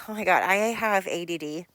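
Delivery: loud, exasperated self-quote; overwhelmed exasperation. Retold or imitated. Podcaster mocking her own reaction to long stories, loud and exasperated. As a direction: blurt it out as if swamped by too much detail. Quoting herself, overwhelmed by detail.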